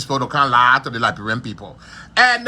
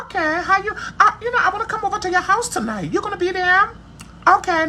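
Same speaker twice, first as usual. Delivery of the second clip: much higher, sing-song feminine phone chat; girly woman's voice. Shown as a demonstration. Showing viewers how a woman should sound on the phone, high feminine voice. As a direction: put on a high dainty lady's voice. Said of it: "You have to sound like a woman".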